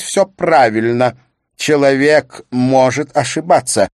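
emphatic husband. Acted. Husband lectures wife in quarrel.

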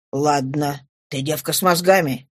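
low, understated father's voice; approving father. Acted. Father approves of his clever daughter, low approving voice. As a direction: drop low and concede it.

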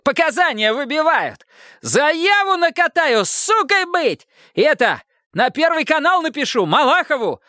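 very high-pitched, thin ranting; agitated threats. A narrator's reading. Detainee threatening police with television exposé, high agitated rant. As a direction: threaten them shrilly with exposure.

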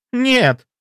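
high, loud cry of refusal; screamed. A narrator's reading. Mistress refusing to accept it, high anguished scream. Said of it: "она закричала".

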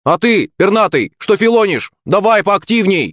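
high-pitched urging call. A narrator's reading. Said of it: "крикнул я Рэмбо".

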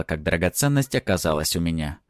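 usual speaking voice

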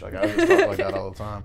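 high, laughing mock British voice; British accent. Shown as a demonstration. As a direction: joke in a fake British accent. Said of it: "a really good British accent".

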